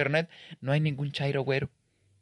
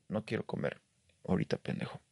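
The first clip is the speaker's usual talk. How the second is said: whispered, much softer grumble; under-breath mutter; 5 semitones lower, 10 dB quieter than usual. Retold or imitated. Annoyed visitor muttering at the hawker, whispered. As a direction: mutter the insult so he can't hear.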